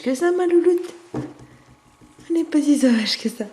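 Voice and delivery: high, loud, slow pet talk. Real, not acted. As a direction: sing it up to the cat. Delighted baby talk to cat.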